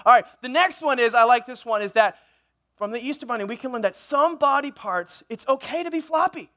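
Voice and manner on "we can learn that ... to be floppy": ordinary talk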